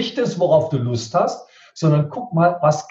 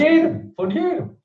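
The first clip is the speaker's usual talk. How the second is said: emphatic answer; 13 semitones higher than usual. Real, not acted. Answers question with emphatic repeat.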